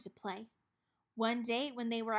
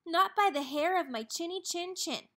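higher, louder little pig; defiant pig; 6 semitones higher, 4 dB louder than usual. A narrator's reading. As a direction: defy the wolf, higher. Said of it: "cried the first little pig".

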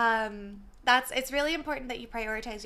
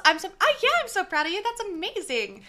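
loud, higher, gushing over the kills; excited praise; 5 semitones higher, 7 dB louder than usual. Real, not acted. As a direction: cheer him on like a proud hype friend. Praising viewer's three kills.

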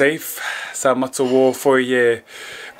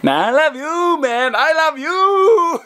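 mock affection; 13 semitones higher, slower than usual.

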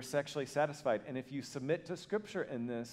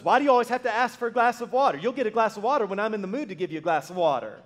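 louder, higher mock-irritated retort; a husband acting put-upon, raised and indignant; 8 semitones higher, 10 dB louder, faster than usual. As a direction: snap back like a put-out husband. Husband snaps at his wife.

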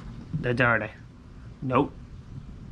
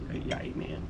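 disappointed mutter; 10 semitones lower, 12 dB quieter than usual. Real, not acted. Disappointed low groan.

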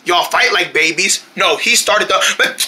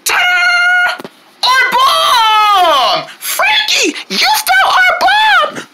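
excited cheer; much slower than usual. Acted. Proud reveal of found ball.

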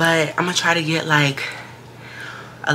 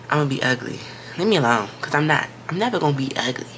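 normal talking voice

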